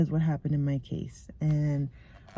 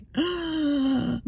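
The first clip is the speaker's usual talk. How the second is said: mock gasp; 8 semitones higher than usual. About to start crying.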